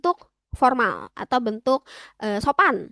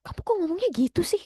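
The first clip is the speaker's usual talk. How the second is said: higher-pitched, mildly indignant complaint; mock scolding; 6 semitones higher, faster than usual. Older Korean objecting to casual speech, mock scolding. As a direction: act out a peeved telling-off, lightly. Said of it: "Udah marah-marah, ngomel-ngomel".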